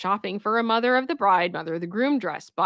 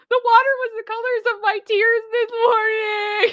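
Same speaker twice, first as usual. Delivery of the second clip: laugh-crying; 12 semitones higher than usual. Real, not acted. Joking about crying with laughter.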